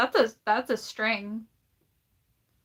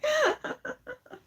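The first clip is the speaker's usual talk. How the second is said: high-pitched giggle. Real, not acted. High laugh.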